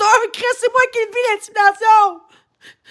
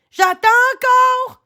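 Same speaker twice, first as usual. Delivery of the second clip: tearful; 7 semitones higher, slower than usual. Real, not acted. Tearful repeat to chat.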